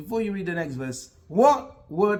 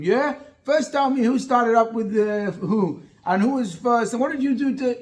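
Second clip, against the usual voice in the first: higher and louder, firing off questions; mock interrogating parent; 6 semitones higher, 6 dB louder than usual. Parent grilling a crying kid about who started it, insistent. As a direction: play the parent who grills instead of hugging.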